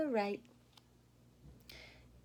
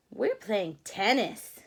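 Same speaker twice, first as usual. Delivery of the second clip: slow, louder smug rabbit reply; rabbits answering; 7 dB louder, slower than usual. Acted. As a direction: say it like proud rabbits. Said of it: "they said".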